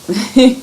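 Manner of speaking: a brief amused giggle; small giggle. Real, not acted. Reader amused by picture of Sarah laughing, small giggle.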